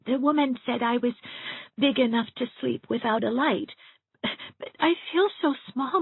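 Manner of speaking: high, small voice near tears; a scared child confessing her fear of the dark; frightened little girl. Acted. Elizabeth telling Miss Shirley she fears the dark and the stuffed crow, high and tearful.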